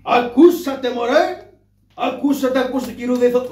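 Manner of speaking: loud, high street crier's shout; loud, stretched town crier calling. Acted. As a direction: cry it out to the whole square. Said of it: "Λοιπόν, αρχίζω".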